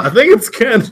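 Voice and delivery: high and loud through laughter; laughing. Real, not acted. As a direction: laugh the words out. Laughing answer about mascot.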